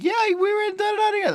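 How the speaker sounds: imitating someone in a very high, quick voice; insistent acquaintance. Voicing a fooled acquaintance who remembers meeting, high-pitched insistence. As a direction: rattle it off high, mocking their insistence.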